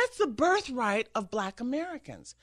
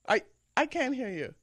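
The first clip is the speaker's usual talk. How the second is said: ordinary talk, nothing marked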